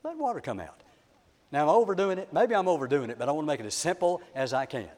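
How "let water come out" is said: soft, sweet, higher-pitched coaxing. Shown as a demonstration. Preacher showing Moses asking the rock very sweetly. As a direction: coax it gently in a small sweet voice. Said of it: "could have said it very sweetly".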